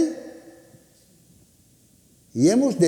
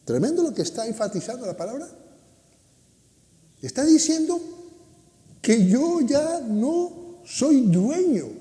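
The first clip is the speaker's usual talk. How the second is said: ordinary delivery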